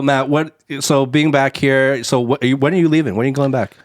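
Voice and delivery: usual speaking voice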